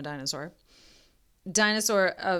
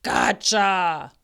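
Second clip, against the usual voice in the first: loud booming gotcha; dinosaur yell; 7 dB louder than usual. A narrator's reading. Dinosaur springing the trap, yelling. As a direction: pounce with a yell. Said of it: "a dinosaur yelling".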